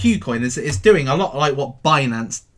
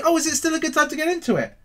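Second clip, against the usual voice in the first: mock whiny question; 9 semitones higher, 3 dB quieter, faster than usual. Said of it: "they say".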